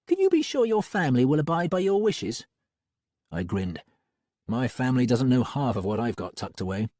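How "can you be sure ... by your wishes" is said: high, pointed female voice; Molly asking sharply; Molly's voice. A narrator's reading.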